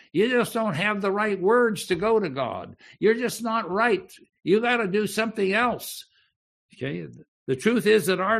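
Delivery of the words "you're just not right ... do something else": ordinary talk, nothing marked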